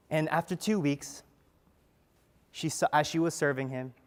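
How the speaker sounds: ordinary talk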